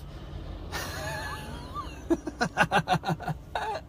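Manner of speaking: high wheezy laugh, fairly soft; long helpless giggle before the punchline. Real, not acted. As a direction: laugh softly and high at your own story.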